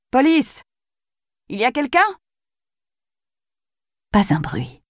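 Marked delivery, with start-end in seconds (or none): 0.1-2.0 high call, questioning at the end. Acted. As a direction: call out again into the silence. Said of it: "cria-t-elle encore". (appel aigu, interrogatif)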